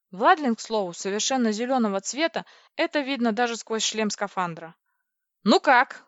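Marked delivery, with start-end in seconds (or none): 5.4-6.0 loud, higher, cheery question; cheerful. A narrator's reading. Heroine to shaken crewmates after battle, cheerful. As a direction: ask it brightly and loudly. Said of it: "весело спросила я".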